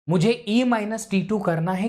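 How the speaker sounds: ordinary talk, nothing marked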